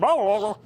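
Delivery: high, loud panicked "oh, oh, oh"; mock panicked oh-oh-oh. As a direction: sputter out alarmed ohs. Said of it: "You went".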